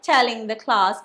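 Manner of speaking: plain reading voice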